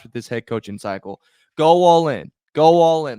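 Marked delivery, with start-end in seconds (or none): 1.6-3.2 loud, punched rallying call; loud rallying cry. Real, not acted. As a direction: shout it at the owner like a fan at a rally. Urging the owner to spend.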